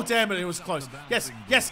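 ordinary talk

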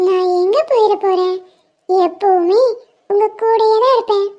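put-on a tiny girl promising to stay; sweet child reassuring her friend; little girl voice.

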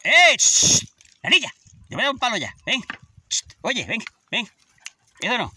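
loud, high shout at the dog; threatening the dog with a stick; scolding shout. Real, not acted. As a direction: bark a warning at the dog.

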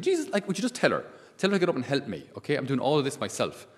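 high, loud, fed-up complaint; exasperated Martha. Acted. Martha, busy serving, complains to Jesus in a high, loud, fed-up voice. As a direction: nag Jesus like an overworked sister.

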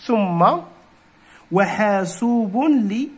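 usual speaking voice

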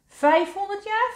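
very high, small questioning voice; little mole voice. A narrator's reading. Molletje guesses the wise one's age, high little questioning voice. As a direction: guess it in a tiny, high pipe.

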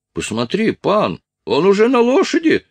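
high, loud cry of alarm; alarmed alert. Acted. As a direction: call the officer's attention urgently. Friend alerts Strus to the escape.

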